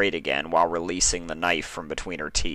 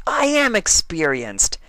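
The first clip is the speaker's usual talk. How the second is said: higher, slower boastful claim; proud declaration; 12 semitones higher, 4 dB louder than usual. A narrator's reading. Dash insisting to Applejack she can throw, slow and proud. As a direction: puff up and declare it slowly. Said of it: "Dash declared".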